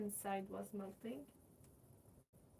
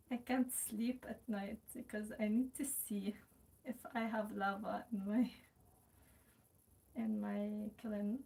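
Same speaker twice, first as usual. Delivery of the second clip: normal talking voice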